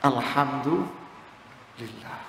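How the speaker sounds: slow, soft calm alhamdulillah; serene acceptance. Acted. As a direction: let it out slowly, at peace. One who understands, accepting.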